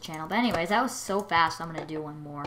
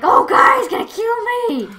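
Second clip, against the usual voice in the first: retold panic; 15 dB louder than usual. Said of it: "and I was like".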